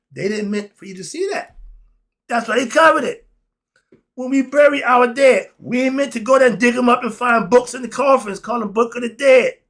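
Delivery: heated outburst about grave robbing; emotional rant. Real, not acted. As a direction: get worked up and let it rip. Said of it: "I'm getting emotional again".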